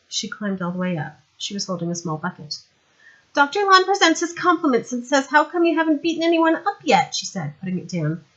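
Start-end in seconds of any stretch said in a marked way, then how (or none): 3.3-7.1 put-on higher, louder young woman, brisk; young woman's voice. Sandra explaining to Vimes how she got there, higher young woman voice. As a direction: play her bright and eager.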